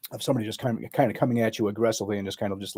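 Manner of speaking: usual speaking voice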